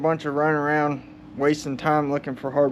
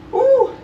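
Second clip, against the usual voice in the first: strained whoop; 7 dB louder than usual. Real, not acted.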